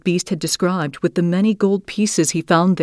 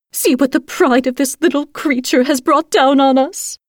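shrill sisters; 8 semitones higher than usual. A narrator's reading. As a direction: whine like a spiteful sister. Sisters complain.

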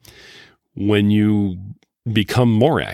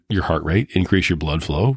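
ordinary talk, nothing marked

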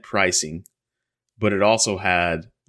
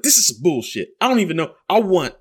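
imitating dad angry; 6 semitones higher than usual. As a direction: rant up high like the baffled dad. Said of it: "And he's like".

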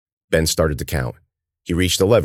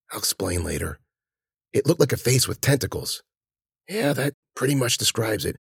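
whispering; 6 semitones higher, 6 dB quieter than usual. Acted. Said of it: "muted whispers".

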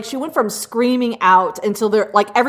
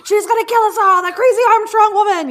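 much higher, squealing alarm; hammed-up panic; 11 semitones higher, 3 dB louder than usual. A Klansman warns Graham in mock high-pitched panic. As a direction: play the frightened man, pitched way up.

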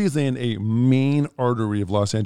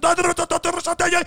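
high-pitched fake Russian rant; screaming mock Russian; faster than usual. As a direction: imitate a man screaming in Russian gibberish. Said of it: "He's screaming at her in Russian".

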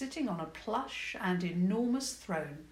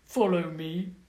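a lightly voiced frog-like goblin, louder; 6 dB louder than usual. Goblin guide invites princess to follow, goblin voice. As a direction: croak it like a small goblin.

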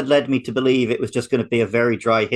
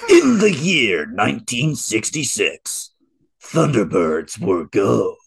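dramatic announcer; much slower than usual. Pretend. Mock rereading of show opening.

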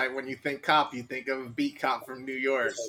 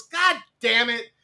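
mock frustration; 7 semitones higher, 4 dB louder, slower than usual. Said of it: "nobody who's having fun ever goes".

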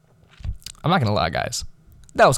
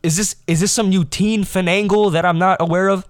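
higher, put-on grown-up voice; mock-suspicious adult, pitched up; imitated baffled adult; 7 semitones higher, 4 dB louder than usual. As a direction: mimic an adult puzzling over teen slang. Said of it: "Oh, he said".

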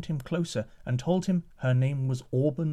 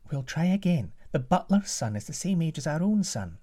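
queen's voice; 5 semitones higher than usual. Acted. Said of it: "The queen turned to the king".

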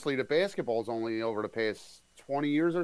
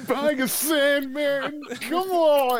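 mocking; 9 semitones higher, 4 dB louder, much slower than usual. Mocking a friend's remark.

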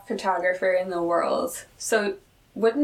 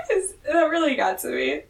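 usual speaking voice

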